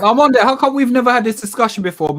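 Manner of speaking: loud, high burst of excitement; excited. Real, not acted. Co-host cuts in on the Irish names talk, excited and loud. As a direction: jump in with sudden excitement. Excited discovery of shared heritage.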